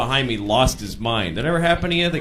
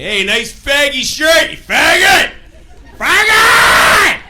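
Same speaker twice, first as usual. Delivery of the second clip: high, loud jeering yell that builds; drawn-out street abuse, louder and louder; heckling shout; 9 dB louder, much slower than usual. Acted. Acted stranger yelling abuse in street.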